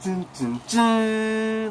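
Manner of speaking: dramatic sting, last dun jumps high; chipmunk dun dun dun. Retold or imitated. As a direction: hum the three beats, last one squeaky high.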